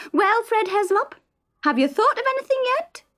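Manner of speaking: high, fast, fretful wife pestering her husband; Isa's nagging voice. Acted. Isa nags husband in bed.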